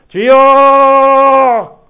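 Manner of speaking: 높고 길게 뽑는 우렁찬 외침; 심야 기도회에서처럼 온 힘으로 부르짖기; 큰 소리로 부르짖는 기도. Acted. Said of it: "큰 소리로 기도합니다". (목청껏 길게 부르짖듯)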